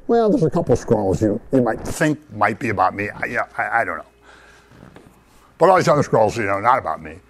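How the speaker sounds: a wishy-washy Jesus who won't commit; mock unsure mumble. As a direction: pretend uncertain, trailing off. Mock hesitant Jesus, hypothetical.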